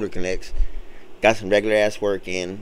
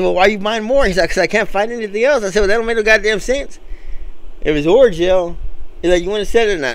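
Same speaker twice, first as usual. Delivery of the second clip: higher, heated back-and-forth; exasperated argument; 7 semitones higher than usual. Retold or imitated. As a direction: argue the point in disbelief. Arguing with the customer.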